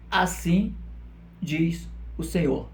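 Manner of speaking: slow, spaced-out prophet's pronouncement; measured declaration, a pause before the verb; prophetic proclamation. Retold or imitated. Preacher quoting Zechariah's words, slow measured proclamation. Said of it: "Zacarias diz".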